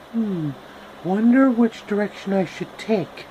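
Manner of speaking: lightly voiced Dorothy. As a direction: wonder aloud like a young girl.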